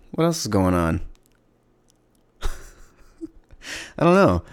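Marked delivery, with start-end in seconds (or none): 2.4-4.4 high, slow words broken by a laugh. Real, not acted. Podcaster laughing at not knowing what to say next. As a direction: laugh it off as you say it. Laughing at his own aimlessness.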